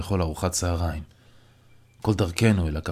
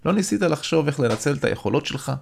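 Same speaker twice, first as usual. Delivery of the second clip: friend's voice, higher, curious question; 7 semitones higher than usual. Acted. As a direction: lean in, genuinely intrigued. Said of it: "שואל אותי ירון מסוקרן".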